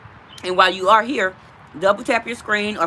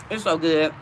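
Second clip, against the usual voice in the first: mouth full; 3 semitones lower than usual. Real, not acted. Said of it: "I'm sorry I'm eating in y'all face".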